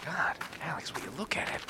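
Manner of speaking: whispering. Acted. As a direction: whisper so the giant won't hear. Awed aside about giant.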